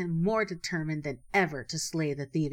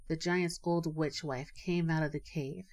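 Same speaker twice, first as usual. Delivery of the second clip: usual speaking voice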